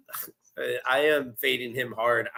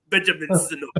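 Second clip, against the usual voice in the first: put-on French accent; 3 semitones higher than usual. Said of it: "I'm gonna go".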